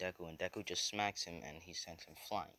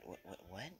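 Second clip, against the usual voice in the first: stunned repetition; 9 dB quieter than usual. Retold or imitated. Acted shocked reply to a reveal.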